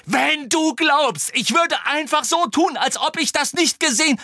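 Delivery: high-pitched, angry interruption; indignant outburst. A narrator's reading. Harry refusing to ignore what he saw, indignant. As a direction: burst in, outraged. Harry cuts back in, quarrel continues.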